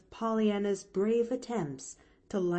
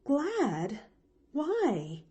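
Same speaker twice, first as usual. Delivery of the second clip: higher-pitched questioning; puzzled; 7 semitones higher than usual. A narrator's reading. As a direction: query it in surprise. Nancy puzzled by child's gladness.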